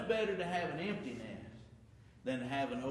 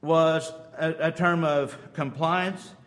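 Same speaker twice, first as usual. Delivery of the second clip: fiery preaching; 11 dB louder than usual. Acted. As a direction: preach it out to the congregation. Preaching at full force.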